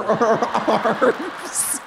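ordinary talk

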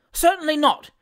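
high-pitched emphatic call; shouted agreement. A narrator's reading. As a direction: call out in support of the crowd. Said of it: "shouted Mister Pickwick".

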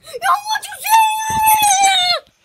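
high, loud, slow challenging yell; angry shout. Pretend. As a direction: shout it like picking a fight. Toy quarrel, challenging.

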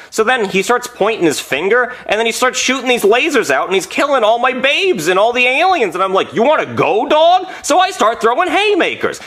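animated, high-pitched action story; worked-up fight retelling. Acted. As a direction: pitch climbing as excitement builds.

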